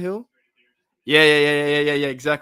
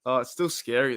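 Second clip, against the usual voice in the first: normal talking voice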